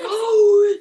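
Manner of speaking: sharp loud high gasp of delight; loud excited gasp. Real, not acted. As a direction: gasp out loud as if you just remembered. Child spots the matching chargers.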